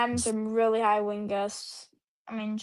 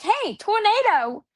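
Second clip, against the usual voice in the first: high, slow excited shout; excited call; 9 semitones higher, slower than usual. Retold or imitated. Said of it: "I was, like, spamming you, like".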